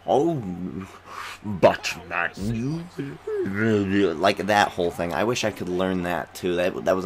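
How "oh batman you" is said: mock villain impression tailing into garbled noise; Bane. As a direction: imitate a theatrical film villain. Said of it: "like Bane from The Dark Knight".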